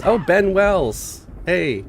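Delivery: high, slow delighted hello; happy greeting. Real, not acted. Greeting a regular in chat.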